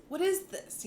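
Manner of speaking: wide-eyed thrilled asking; giddy wonder. Retold or imitated. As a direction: let the question soar up in delight. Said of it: "and you like".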